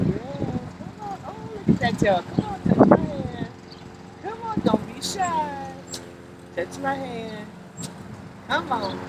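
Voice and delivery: high, soft, eager calls; excited coaxing. Real, not acted. Urging the tree like a puppy, eager high coaxing. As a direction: coax like calling a puppy.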